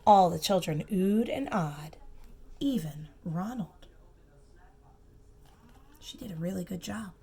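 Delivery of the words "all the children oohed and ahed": ordinary delivery, nothing marked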